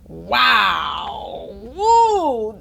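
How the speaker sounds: loud cheer of wow and woo. Acted. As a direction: cheer like the crowd. Reader cheering for the crowd.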